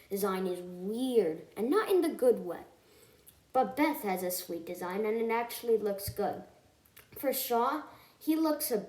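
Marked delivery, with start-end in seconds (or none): none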